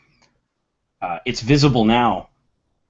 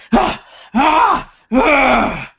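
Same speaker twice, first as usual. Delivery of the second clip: much higher, louder yells with each blow; furious cries; 12 semitones higher, 6 dB louder, much slower than usual. Acted. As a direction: cry out as if smashing the hammer down each time. Pounding the helm in fury.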